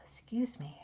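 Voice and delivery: breathy whisper after a sneeze; whispered excuse me. Real, not acted. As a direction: whisper an apology. Right after her sneeze.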